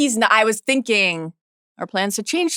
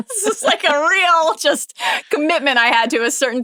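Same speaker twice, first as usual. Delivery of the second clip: laughing; 8 semitones higher than usual. Real, not acted. Laughs at own youthful fashion.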